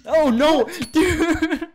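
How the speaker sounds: loud, high yell breaking into laughter; shocked yell. Real, not acted. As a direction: cry out in laughing disbelief. Player dies suddenly in game.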